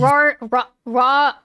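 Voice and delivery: RAW repeated in stuck false starts at a tricky name; stumbling pronunciation. Real, not acted. Streamer failing to say a character's name, stumbling on RAW. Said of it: "I can't say that either".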